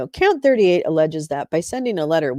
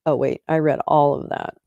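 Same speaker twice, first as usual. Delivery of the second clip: ordinary delivery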